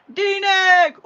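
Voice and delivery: loud, high shout of a name; calling out. Real, not acted. Crafter calling to the viewer whose pen it is, shouting. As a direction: holler the name excitedly.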